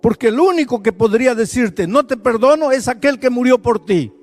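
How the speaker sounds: loud, high, impassioned preaching; preaching at full force. Acted. Preacher urging a paralysed woman to accept forgiveness, fervent. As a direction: build to a fiery climax.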